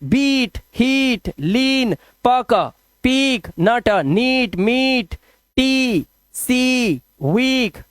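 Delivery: each word with a held "eee", like बीट, हीट; long vowel drill. Shown as a demonstration. Teacher drilling ea words for pupils, long vowel drill. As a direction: sound out and stretch the ई in every word. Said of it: "long vowel sound ई की आवाज".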